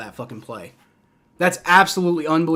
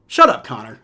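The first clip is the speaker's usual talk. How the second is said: snapping; 5 semitones lower, 4 dB louder than usual. Real, not acted. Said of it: "I'm not trying to, like, snap at you".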